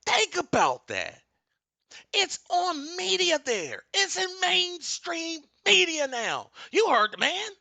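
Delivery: very high, loud shouted preaching; booming preaching. Real, not acted. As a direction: build it to a shouting climax. Preaching rant climax.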